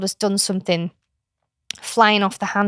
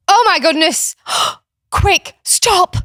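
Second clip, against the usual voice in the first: high, loud mock alarm with a gasp; hammed-up gasp of alarm to distract a child; 10 semitones higher, 6 dB louder, slower than usual. Aunt fakes alarm to stop her niece's tantrum, high gasping alarm.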